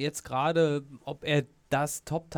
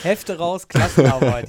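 strict teacher; 13 dB louder than usual. Pretend. Joking about going through notes.

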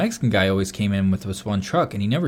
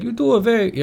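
put-on higher, botched Mexican accent; attempted Mexican accent; 12 semitones higher than usual. Imitating the coworker in the shop, shaky-attempt Mexican accent. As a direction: try a hard Mexican accent and miss. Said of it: "he had a very hard Mexican accent".

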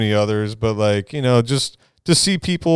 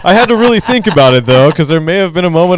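excited; 9 semitones higher, 7 dB louder than usual. Real, not acted. Admits he may have cried.

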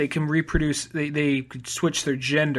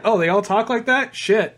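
usual speaking voice

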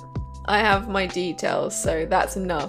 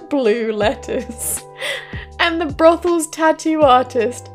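laughing while reading; 3 semitones higher, 3 dB louder than usual. Real, not acted. Reading funny news story.

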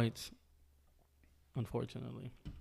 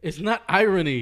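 loud insistence; 6 semitones higher, 9 dB louder than usual. Real, not acted. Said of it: "Say it again".

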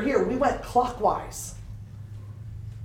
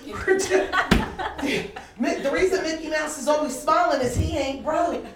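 laughing; 5 semitones higher, slower than usual. Real, not acted. Joke about Disney costs.

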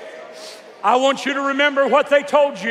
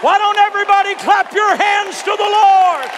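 rousing shout; 7 semitones higher than usual. Real, not acted. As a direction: rouse the crowd like thunder. Urging crowd to clap.

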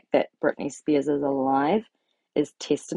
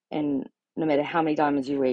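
ordinary talk

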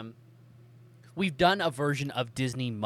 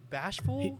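amused echo; 11 semitones higher than usual. Real, not acted. Cohost teases a friend who says he feels bashful with a high mock echo. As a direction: tease the shy friend by tossing the word back up high. Echoing a cohost's shy word.

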